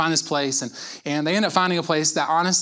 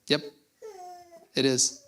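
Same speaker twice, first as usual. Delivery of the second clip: calm God; 13 semitones higher, 8 dB quieter than usual. Retold or imitated. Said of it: "And God goes".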